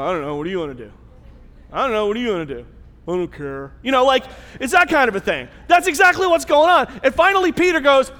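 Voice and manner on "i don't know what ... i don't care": put-on bored teenagers. Bored kids bouncing the question.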